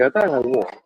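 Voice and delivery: usual speaking voice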